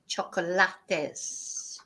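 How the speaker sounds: "chocolates" drawn out in Spanish, cho-co-LA-tes; four-syllable Spanish "chocolates", stress on LA; Spanish pronunciation. Shown as a demonstration. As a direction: say "chocolates" slowly, sounding every Spanish vowel. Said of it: "whereas in Spanish".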